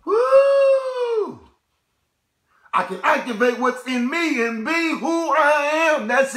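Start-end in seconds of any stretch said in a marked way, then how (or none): 0.1-1.4 exultant woo shout. Real, not acted. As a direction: let out a triumphant whoop. Preacher exults again.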